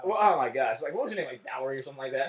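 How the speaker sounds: mock valley girl. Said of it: "I'm like".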